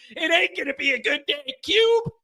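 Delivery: mocking retort. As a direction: throw it back at him, then shout his name. Teasing [name] about snake.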